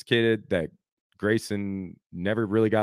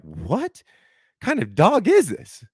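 retold disbelief, pitch leaping high and loud; incredulous outburst; 9 semitones higher, 5 dB louder, slower than usual. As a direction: blurt it out, aghast, drawing out the question. Retold shock at dog attack.

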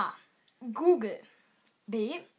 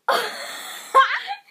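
real giggle fit; laughing; 8 semitones higher, 6 dB louder than usual. Real, not acted. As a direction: crack up laughing. Outtake, cannot stop laughing.

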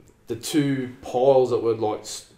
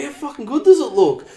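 proud boast; 13 semitones higher, 9 dB louder than usual. Retold or imitated. Proudly showing off the wheels.

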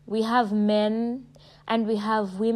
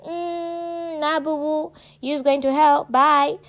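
higher, sassy brush-off that gets louder by the end; sassy dismissal; 6 semitones higher, 4 dB louder, slower than usual. Pretend. God brushing off a sinner, sassy and final. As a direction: wave them off like it's already decided. God's dismissive reply in skit.

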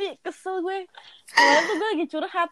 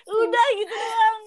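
higher whining cry; whiny wail; 9 semitones higher, slower than usual. Real, not acted. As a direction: wail it in mock despair. Repeats louder as a whining protest.

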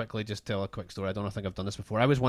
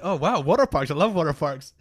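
mock excited; 4 semitones higher, 6 dB louder than usual. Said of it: "Like a complete and utter loser".

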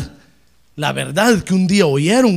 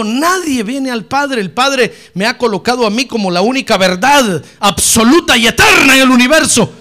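Jesus' words, preached; 4 semitones higher, 9 dB louder than usual. Acted. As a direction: declare it as the voice of the Lord. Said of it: "Él dijo".

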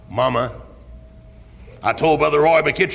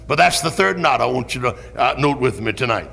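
normal talking voice

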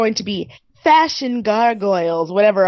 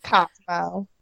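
a softer, quieter Southern Cosmo, KAHZ-mo with a rise; Southern accent; 3 semitones lower, 6 dB quieter than usual. Shown as a demonstration. Repeating Cosmo for the roommate's acting class in Southern accent. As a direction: try Cosmo again, drawled and soft. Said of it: "how to say Cosmo in a Southern accent".

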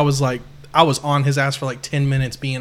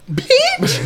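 squeaky, much higher mock Mikey; Mikey's voice; 3 dB louder than usual. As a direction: squeak the word Bitch like Mikey does. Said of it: "You know how he talks".

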